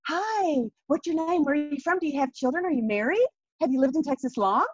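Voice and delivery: high-pitched, gushing mock cheeriness; over-happy greeters. New colleagues quizzing the newcomer, hammed-up happy greeting. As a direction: imitate relentlessly happy people firing friendly questions. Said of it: "People were so happy".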